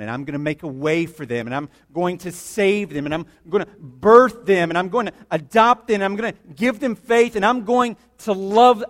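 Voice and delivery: building from normal to loud and high; God's mounting resolve. Retold or imitated. God promising to save his people, building fervently. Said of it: "He said".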